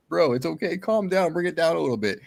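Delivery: high-pitched, coaxing calm-down; mock placating. Speaker calming his worked-up friend, mock placating.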